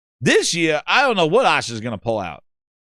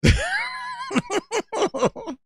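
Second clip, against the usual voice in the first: high-pitched helpless laughing fit; laughter; 4 dB quieter than usual. Real, not acted. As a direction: break into a long high cackle. Cracks up at own bit.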